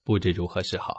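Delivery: ordinary delivery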